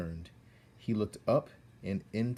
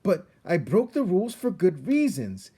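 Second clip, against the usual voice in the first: higher, louder plea; raised voice; 11 semitones higher, 10 dB louder than usual. Acted. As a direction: push back at the council. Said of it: "He raised his voice".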